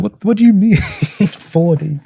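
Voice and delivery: high-pitched, laughing incredulity; baffled reply. Retold or imitated. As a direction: act out being thrown, cracking up midway. Retold baffled reply to the accusation.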